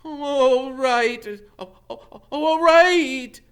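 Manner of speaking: high, loud, slow broken giving in; tearful stammering Quirrell; sobbing. A narrator's reading. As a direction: sob out the surrender. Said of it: "he heard Quirrell sob".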